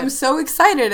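bubbly and eager; can barely contain the excitement; excited. Real, not acted. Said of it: "as if you can't tell".

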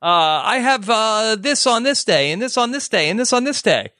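hammed-up hemming and hawing; imitating a reluctant wife. Acts out wife dodging the booking.

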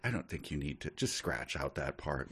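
very soft, low murmured advice; hushed, tactful suggestion; discreet aside. Acted. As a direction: murmur it over the writer's shoulder. Someone behind the writer advising.

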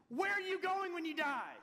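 high, loud, frantic blurt. Retold or imitated. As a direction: imitate a panicked teenager accosting a stranger. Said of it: "like, a, f-, a frantic".

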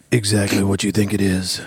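low, put-on superhero voice; Batman voice. Shown as a demonstration. Host unveils his Batcast voice, mock Batman. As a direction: drop it low like a brooding Batman. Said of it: "I call it Batcast".

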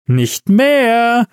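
high, drawn-out tipsy reply; giddy Santa. A narrator's reading. Santa dismissing his old name, giddy. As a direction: sing it out gleefully, about to giggle. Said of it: "meinte der Weihnachtsmann und begann zu kichern".

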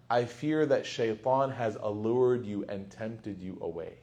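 soft, low, slow warning to a father; quiet, careful reproach without blame; gentle prophetic concern. Retold or imitated. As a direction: voice a son's tender worry, hushed and measured. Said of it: "they speak like this".

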